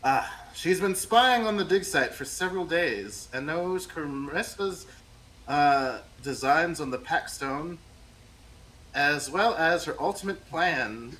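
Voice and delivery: a hint of a calm elf; calm elven NPC. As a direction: play her calm, elven, composed. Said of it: "she's very calm and, you know, elven".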